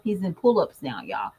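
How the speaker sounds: ordinary talk, nothing marked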